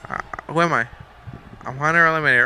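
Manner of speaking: normal talking voice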